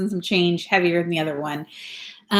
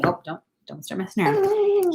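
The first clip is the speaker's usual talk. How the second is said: much higher, softer playful scold; 12 semitones higher, 5 dB quieter than usual. Real, not acted. Mom playfully warning her son, high soft baby talk.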